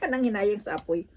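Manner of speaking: laughing. Real, not acted. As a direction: laugh through the whole line. Laughs at her own thrift.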